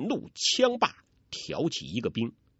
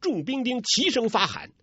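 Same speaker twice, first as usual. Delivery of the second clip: usual speaking voice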